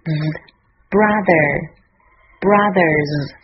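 very slow "brothers" with a buzzing TH; tongue between teeth, voice the TH in "brothers"; voiced TH. Shown as a demonstration. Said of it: "咬舌声带震动".

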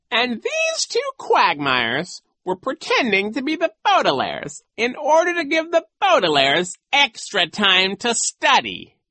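high, loud, theatrical villain; gloating villain coach. Acted. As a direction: relish the nasty tale. Voices Genghis.

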